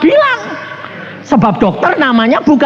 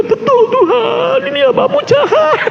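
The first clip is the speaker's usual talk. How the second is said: mock sobbing. Visitor weeps to God in confession, mock sobbing. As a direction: bawl it out in a hammed-up high voice. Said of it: "Nangisnya tiga kali lipat".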